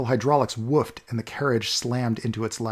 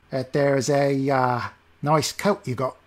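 put-on sly old beggar; slower than usual. Greedy old beggar eyes coat.

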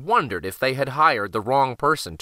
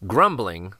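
usual speaking voice

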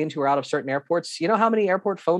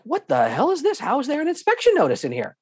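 acting out a baffled friend, high-pitched; much higher, mock disbelief at what was found; incredulous outburst; 12 semitones higher than usual. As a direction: retell it as stunned indignation. Friend's shock on opening case.

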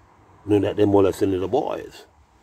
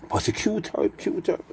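"Prosecutor, cuter" pitched way up; high wordplay; 10 semitones higher than usual. Shown as a demonstration. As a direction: squeak out "Prosecutor", then echo "cuter" just as high. Plays on the word's ending.

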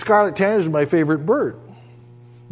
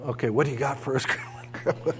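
greedy grandkids voiced; 5 semitones lower, 10 dB quieter, faster than usual. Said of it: "It's like they come into our house".

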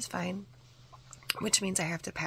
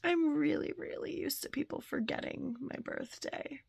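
close to tears; 8 semitones higher than usual. Real, not acted. Telling viewers a forgotten birthday was remembered, near tears. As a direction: let the tears almost break through as you thank them. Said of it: "I am about to cry on camera".

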